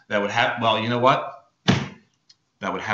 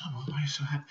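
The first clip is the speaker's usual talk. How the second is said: hushed, whispery mother voice; puzzled mom; 2 semitones higher, 12 dB quieter, much faster than usual. Acted. Mom puzzled by her happy daughter, hushed and whispery. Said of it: "And she goes".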